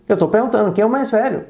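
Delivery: ordinary delivery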